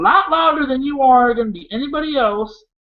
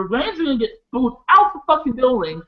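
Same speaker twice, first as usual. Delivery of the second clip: usual speaking voice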